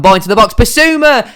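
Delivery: rising to a sharp high yell on the name; excited. Real, not acted. Commentating his own match, yelling the player's name in excitement. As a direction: voice leaps up as the chance opens. Chance builds in live commentary.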